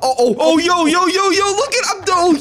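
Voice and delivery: high, rapid-fire exclamations; excited yelling. Pretend. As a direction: whoop it up at the spectacle. Watching figure get thrown down hole.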